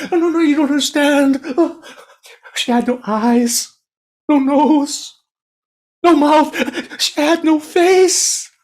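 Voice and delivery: can't get the words out; panicked stammer. Acted. Terrified merchant stammers to the soba seller, high panicked.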